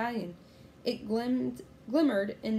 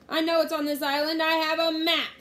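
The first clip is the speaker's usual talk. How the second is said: loud, sustained high bluster; pirate roar; 8 semitones higher, 8 dB louder than usual. A narrator's reading. Captain Bones insisting on treasure, loud roar. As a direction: keep roaring it out. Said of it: "roared Captain Bones".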